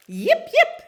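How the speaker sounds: high, loud little yips; sharp, squeaky puppy yelps; dog yips. Acted. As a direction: yip like an excited dog. Dog yipping in story.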